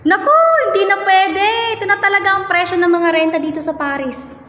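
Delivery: high, emphatic refusal; dismissive landlady. Acted. Landlady refuses to lower rent.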